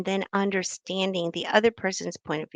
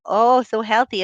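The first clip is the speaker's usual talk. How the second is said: a hint of the aunt's louder fake compliment; mock aunt; 3 semitones higher, 7 dB louder than usual. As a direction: lightly voice the aunt's backhanded praise. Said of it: "the next time she sees you".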